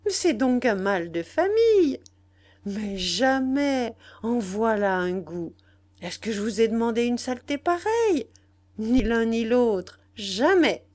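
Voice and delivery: loud, scandalised outburst; indignant cry. A narrator's reading. Nana refuses both brothers, in loud indignation. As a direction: exclaim in outrage, hammering the refusals. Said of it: "cria-t-elle".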